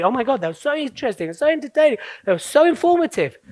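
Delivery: acted rave review, rushed at first; gushing audience member. Acts out impressed audience reaction.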